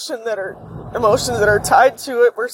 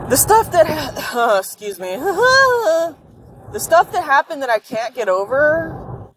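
fighting back tears to get the words out; crying; 5 semitones higher, slower than usual. Real, not acted. Alone on camera, confessing what still hurts, crying. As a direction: voice breaking, slow and tearful. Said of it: "I need to stop crying".

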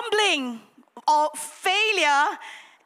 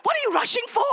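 a hammed-up furious snap at the rushing groomsman; angry retort; 8 semitones higher than usual. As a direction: tell off the groomsman with comic outrage. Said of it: "I said".

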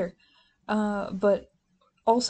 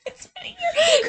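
mock wail; 5 dB louder than usual. Mock-wailing king's complaint.

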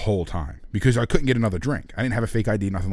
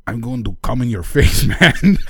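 louder, breaking into laughter on the last word; laughing retelling; 9 semitones higher, 7 dB louder than usual. Retold or imitated. Storyteller repeats his translated blunder to co-host, cracking up. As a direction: blurt it out loud, cracking up.

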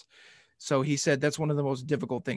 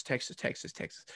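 mock whisper; 13 dB quieter than usual. Mocking fans' obsession.

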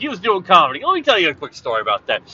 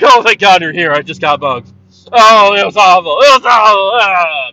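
loud, hammed-up helpless damsel; a theatrical distressed-victim plea to the police; 4 semitones higher, 9 dB louder than usual. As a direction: wail it like a swooning damsel in distress. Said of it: "be damsel in distress".

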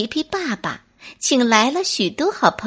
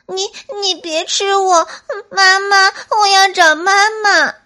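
高声哭腔求饶; 吓坏了的小松鼠带着哭腔; 哭着说; 9 semitones higher, 6 dB louder than usual. Acted. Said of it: "哭着说". (哭着求饶)

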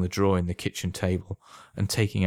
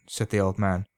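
ordinary delivery

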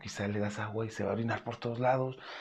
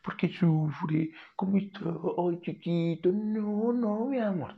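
put-on higher and louder, fretful baby talk; anxious pitying tone; 7 semitones higher, 4 dB louder, slower than usual. Shown as a demonstration. Owner fretting over a suffering cat, anxious pitying coo. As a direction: smother the cat in worried pity. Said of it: "No utilizo el tono de voz de".

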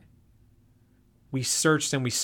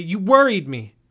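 louder, upset reproach; 5 semitones higher, 7 dB louder than usual. A narrator's reading. Josie tells the narrator he scared her, loudly upset. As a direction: let the worry burst out loud. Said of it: "Josie asked angrily".

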